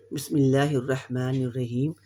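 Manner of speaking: measured Quranic recitation. Acted. Teacher starting the practice with a recited invocation. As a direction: intone the bismillah before the drill.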